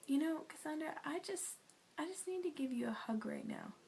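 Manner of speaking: imitating a gentle, concerned pastor; tender, hesitant offer. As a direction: offer comfort gently, feeling for the words. Retold pastor's tender offer of a hug.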